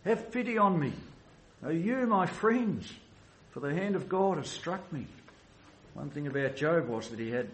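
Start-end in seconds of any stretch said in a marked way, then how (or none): 0.0-5.1 desperate cry. Retold or imitated. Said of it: "you feel something of the desperation of Job's cry".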